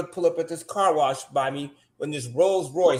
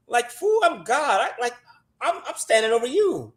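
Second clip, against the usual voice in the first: high, mocking voice of God; a lightly voiced God scoffing down at a fool; 7 semitones higher than usual. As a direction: play God scoffing at the listener.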